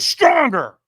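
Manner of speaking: high, loud coaching shout; trainer's demand. Retold or imitated. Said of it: "And he went".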